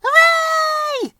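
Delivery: put-on high, loud children's cheer. Children on the bumpy ride, high cheering shout. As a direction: cheer with delight. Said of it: "cheered the children".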